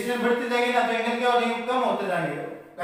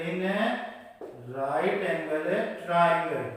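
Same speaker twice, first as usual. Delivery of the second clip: slow dictation; 4 semitones lower, much slower than usual. Shown as a demonstration. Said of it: "again note करते हैं".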